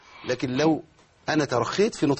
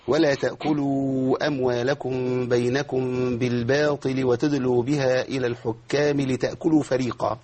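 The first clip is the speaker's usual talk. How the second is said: slow recitation halting abruptly on فريقا; misplaced stop; 2 semitones lower, slower than usual. Shown as a demonstration. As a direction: chant straight through, then stop dead after فريقا. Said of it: "وقف غريب جدًا".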